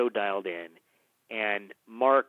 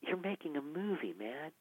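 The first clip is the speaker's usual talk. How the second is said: quiet nudge; 5 semitones higher, 12 dB quieter than usual. Retold or imitated. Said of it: "He goes".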